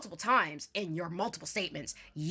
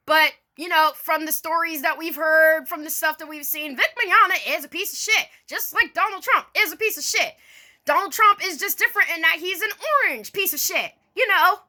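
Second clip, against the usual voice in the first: higher, emphatic declaration; heated; 6 semitones higher than usual. Real, not acted. Vlogger telling viewers her opinion, heated and emphatic. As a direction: drive the point home with heat.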